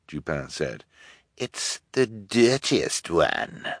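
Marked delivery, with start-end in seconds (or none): 1.4-3.7 slow, softer, affected menace; oily villain, drawn out and quiet; sinister fake detective. A narrator's reading. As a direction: taunt prisoners under your breath. Fake detective taunts his prisoners.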